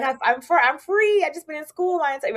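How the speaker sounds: high, bright burst of giddy relief; giddy freedom. Retold or imitated. Graduate mocking her giddy post-law-school self, excited. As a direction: squeal it like you just got free.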